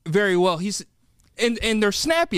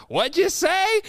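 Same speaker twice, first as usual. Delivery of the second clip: put-on high, rising old man's query; hard-of-hearing grandpa; 13 semitones higher, slower than usual. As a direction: ask high and puzzled, as if you missed it. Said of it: "Vernon can't hear very well".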